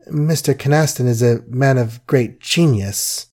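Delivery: usual speaking voice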